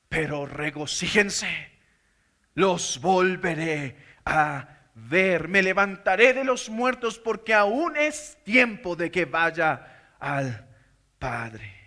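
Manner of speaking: gentle reassuring preacher voice. Acted. Tender reassurance in Jesus' voice.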